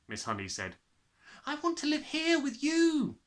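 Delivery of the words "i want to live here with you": put-on high pleading child's voice; a girl pleading with her teacher; crying out. A narrator's reading. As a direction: beg to stay. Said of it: "Matilda cried out".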